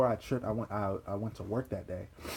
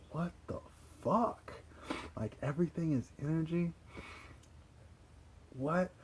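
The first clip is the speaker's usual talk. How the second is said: incredulous; 8 semitones higher, 4 dB quieter than usual. Retold or imitated. Stunned in the shower, retold.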